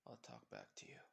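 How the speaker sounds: low murmur. Acted. Izuku cuts radio, spotted someone.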